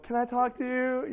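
high, slow, hesitant request; mock nervous. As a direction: ask it like a comically scared teenager. Nervous boy asks dad to talk.

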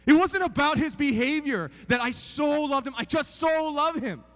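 loud, high, fervent preaching; preaching at full force. Real, not acted. Preacher tells the crowd about love, at full force. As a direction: lift it up and drive it home.

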